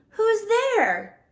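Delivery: high startled call, Mouse hearing a noise; mouse cries out. Acted. Mouse calling into the dark den, high and startled. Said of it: "Mouse cries".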